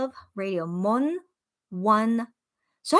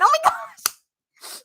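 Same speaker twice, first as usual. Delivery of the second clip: high, slow "oh my gosh" of mock horror; high-pitched gasping exclamation; shocked squeal; 3 dB louder, slower than usual. Real, not acted. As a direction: squeal it, caught off guard. Reacting to moron comment.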